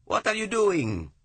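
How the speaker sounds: high, loud, slow outraged scream. A narrator's reading. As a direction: shriek it at your captor. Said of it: "Pietro Bacchi screamed".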